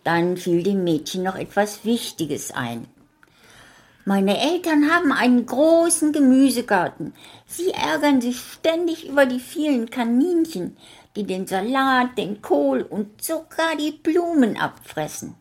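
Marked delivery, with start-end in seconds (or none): 4.1-15.4 put-on high, bright child voice throughout; little girl talking. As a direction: chatter kindly as the little girl.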